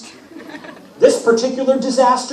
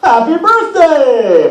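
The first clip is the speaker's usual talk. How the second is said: slow, much higher and louder sing-song; Apollo's cheery wish; 8 semitones higher, 7 dB louder, slower than usual. Acted. Apollo wishes Grover happy birthday, slow and sing-song. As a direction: stretch the wish out showily. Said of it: "Apollo said".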